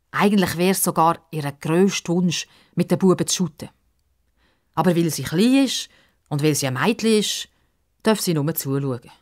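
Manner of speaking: usual speaking voice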